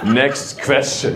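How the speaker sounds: slow, curt German deadpan; precise German. Retold or imitated. German audience member finished with the brand question, precise deadpan. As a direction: dismiss it slowly, with measured precision.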